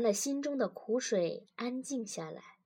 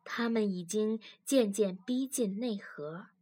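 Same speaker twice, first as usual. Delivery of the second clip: usual speaking voice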